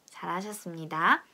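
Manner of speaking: ordinary talk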